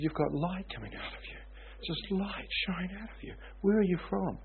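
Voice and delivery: a hint of an amazed young man; awed young guy. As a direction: echo the stranger's wonder at the light. Young guy marvels at light.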